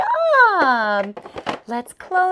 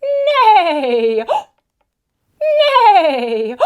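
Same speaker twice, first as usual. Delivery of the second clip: horse neigh; 3 semitones higher, 6 dB louder than usual. Pretend. Teacher voices horse neigh.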